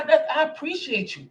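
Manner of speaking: normal talking voice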